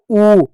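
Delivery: ऊ drawn out as a long "oo"; long vowel. Shown as a demonstration. Teacher sounding out the big vowel for children, long vowel. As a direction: hold ऊ long and firm. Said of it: "बड़ा उ".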